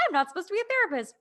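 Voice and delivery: high, loud and fast; quick giddy epiphany; excited realization. Retold or imitated. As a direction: rattle it off in a rush of discovery. Retold realization about her career.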